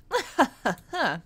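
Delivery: villain laugh. Acted. Villainess laughing after threat.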